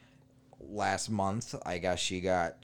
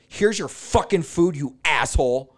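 loud, higher, slow furious retort; angry snap; 5 semitones higher, 7 dB louder than usual. Retold or imitated. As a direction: throw the words at him in anger. Ex throws bag, insults him.

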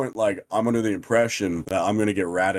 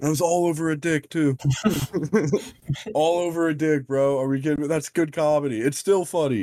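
high, giggly laughter through the words; laughing at his own punchline; cracking up; 8 semitones higher than usual. Real, not acted. To his friends, laughing at the dumb story, giggling high.

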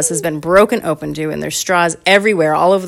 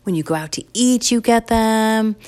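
lightly voiced kids, higher pitch; pleading kids; 6 semitones higher than usual. Kids defend drinking straws to parents, lightly pleading.

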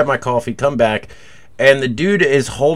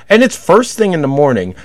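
ordinary talk

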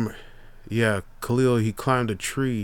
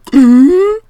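mock gasp; 16 dB louder than usual. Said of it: "it's like gasping".

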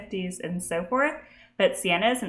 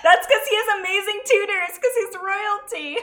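higher, laughing through the words; 11 semitones higher than usual. Real, not acted. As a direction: still giggling while explaining. Still laughing about the accent.

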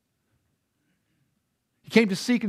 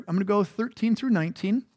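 ordinary delivery

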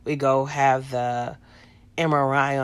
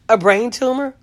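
incredulous question; 9 semitones higher, 7 dB louder than usual. Retold or imitated. Disbelieving echo of doctor's news.

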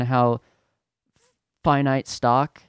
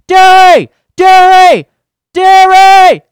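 very loud, high yell, word by word; mock a customer bellowing for service; hollering for staff; 19 dB louder, slower than usual. Rich dude calling the dairy worker beside him, hollering.

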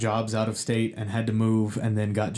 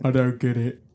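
dumb guy voice. Acted. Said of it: "I was just doing my dumb guy voice".

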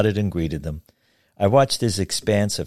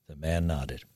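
ordinary delivery, nothing marked

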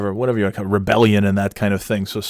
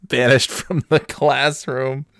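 words through laughter, higher and slower; laughing; 5 semitones higher, slower than usual. Real, not acted. Storyteller recalling his banishment, laughing. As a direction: crack up while saying it.

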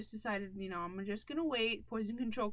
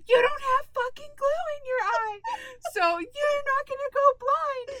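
high, loud crying shout; sob-yell it in a panic; panicked crying outburst, much higher and louder; 13 semitones higher, 11 dB louder than usual. Retold or imitated. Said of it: "I snapped so bad because I was like".

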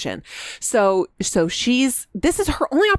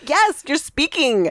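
cheering relief; 7 semitones higher, slower than usual. Retold or imitated. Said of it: "Everybody's like".

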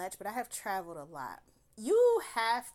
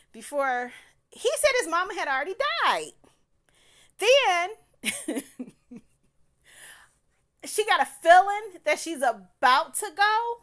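higher and louder, fast disbelief; incredulous outburst; 9 semitones higher, 11 dB louder than usual. Real, not acted. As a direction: call out the lie, voice rising in outrage. Exposing the liar's contradictions.

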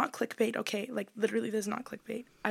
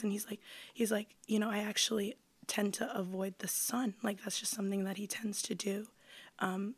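whispering; 6 dB quieter than usual. Retold or imitated. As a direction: lean in and whisper the creepy detail. Whispered vampire date story.

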